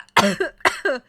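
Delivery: harsh choking coughs; coughing fit. Real, not acted. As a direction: cough hard after swallowing wrong. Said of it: "C'est dur de boire".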